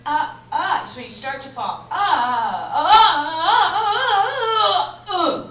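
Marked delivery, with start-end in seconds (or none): 0.0-0.8 short high startled gasps; start of a fall. Shown as a demonstration. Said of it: "it's supposed to be done like this". 1.9-5.5 high, loud tumbling yells; proper falling scream. Shown as a demonstration. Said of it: "That's the fall".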